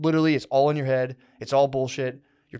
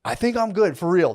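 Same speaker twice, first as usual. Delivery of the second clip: insisting; 6 semitones higher, 4 dB louder than usual. Retold or imitated. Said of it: "I'm like".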